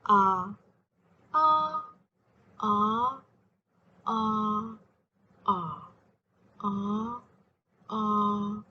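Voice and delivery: ah through all six tones, one after another; each ah on its own Cantonese tone; ah sung across the six tones. Shown as a demonstration. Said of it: "a demonstration of the six tones".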